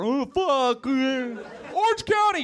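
high, drawn-out mock yell; mock heckler yell. Shown as a demonstration. Showing the audience what to yell out, mock heckle. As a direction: call it out like a slow heckler. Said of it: "You're supposed to yell out".

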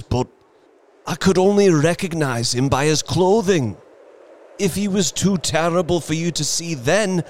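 falter, then rise into anguished pleading; shaking voice. A narrator's reading. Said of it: "and his voice shook".

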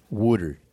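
WATER as "wooder", pitched low; Philly pronunciation. Shown as a demonstration. Showing how Philly people say it, WATER as "wooder". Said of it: "That's how they say it".

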